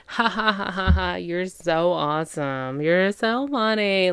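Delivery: fake, overdone ha-has and gushing praise; put-on hysterical laughing. Kate overdoes laughing at her date's remark, hammed-up hilarity. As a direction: laugh too hard to flatter a date, drawing out the compliments. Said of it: "She's laughing hysterically".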